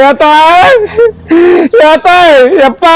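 slow tearful wail calling out for mother; crying for mother. Acted. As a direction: sob out the call, drawn out and rising. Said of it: "என்ன நீ என்ன அழுதுகிட்டு வர்ற". (அம்மாவை அழைத்து அழுகை)

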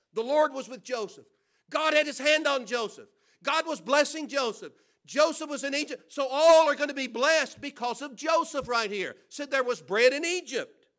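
loud preaching proclamation. Acted. Preacher proclaiming God's blessing on Joseph, loud and high. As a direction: proclaim it to the back row.

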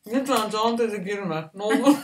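ordinary talk

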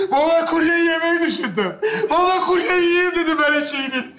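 high, whining hungry breakdown; tearful wailing. Acted. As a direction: wail it to your father. Starving son breaks down to father.

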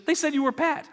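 catching a name mix-up; puzzled surprise. Retold or imitated. Retold puzzled question.

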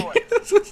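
short high-pitched laugh; amused laugh, pitched well up. Real, not acted. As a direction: burst out in a brief, high giggle. Laughing at being spat on.

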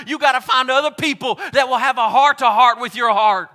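high, loud preacher's climb; preaching at full force. Acted. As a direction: build the sermon to a shout. Preaching climax continues.

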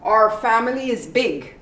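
ordinary delivery

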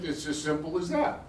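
ordinary talk

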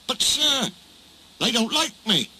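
high-pitched, hesitant objection; anxious protest. A narrator's reading. Duck worrying to the Controller, anxious protest.

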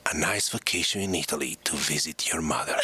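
lightly voiced mother, pitched low; mother's letter voice. Son delivering mother's guilt-trip payoff, low put-on voice. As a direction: land the punchline low, as mother. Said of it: "she was doing the voice like this".

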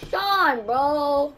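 high, loud frustrated protest; exasperated yell. Real, not acted. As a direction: yell at the opponent in dismay. Opponent breaks free.

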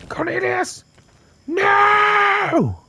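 screaming the name, voice cracking high and loud; real shrieking no; anguished scream. Real, not acted. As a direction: scream for a friend just killed. Friend killed in game, screaming.

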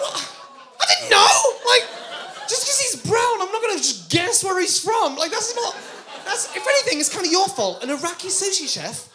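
loud, high-pitched comic outrage; indignant ranting. Acted. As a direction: protest at full volume, pitch shooting up. Acted indignant protest at waiter's explanation.

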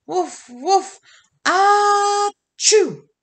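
loud, high woof woof building to a big sneeze; bark, then explode into a huge "ah-choo". Acted. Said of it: "Dog huffed and he puffed".

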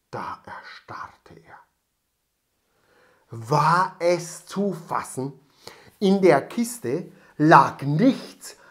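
slow, hushed then swelling; dramatic suspense. A narrator's reading. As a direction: build from whisper to loud disbelief. Shock discovery narration. (von Flüstern zu lautem Entsetzen)

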